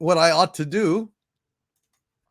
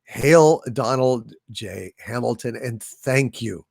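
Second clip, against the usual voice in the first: slow, low and grand; a slow regal salute; solemn hail; 7 semitones lower than usual. Acted. Answering a fan's hail, slow and grand. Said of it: "To which I reply".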